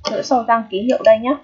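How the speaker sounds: ordinary talk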